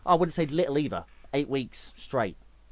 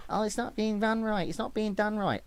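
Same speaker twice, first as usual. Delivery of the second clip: higher, softer mocking whine; whining crybabies; 5 semitones higher, 5 dB quieter than usual. Streamer mocking community complainers, whiny. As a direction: mimic complainers in a high little whinge. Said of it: "All you fucking crybabies say is".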